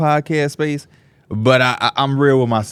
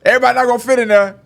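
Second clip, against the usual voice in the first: mock flustered self; 12 semitones higher, faster than usual. Quoting himself, high and loud.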